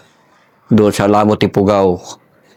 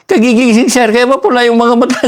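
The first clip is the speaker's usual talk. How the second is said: pleading excuse; 11 semitones higher than usual. Retold or imitated. Soldier's excuse to officer.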